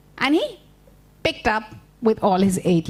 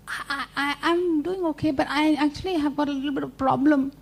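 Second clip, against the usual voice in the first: high, softer stammering spider; hesitant Anansi; 5 semitones higher, 4 dB quieter than usual. Acted. Anansi confides a problem to rabbit, high hesitant stammer. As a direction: stammer out a shy admission.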